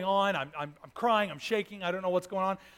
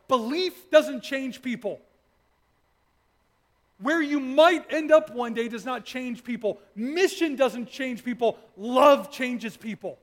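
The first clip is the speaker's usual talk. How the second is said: emphatic preaching; 4 semitones higher, 7 dB louder, slower than usual. Acted. Preaching at full force.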